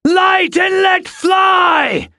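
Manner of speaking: high, drawn-out battle shout; screamed command. Acted. Captain ordering archers to loose flaming arrows, long screamed command. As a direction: scream the order slowly over the whole army.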